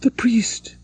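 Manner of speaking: faint horrified cry. Acted. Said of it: "said she in a faint voice".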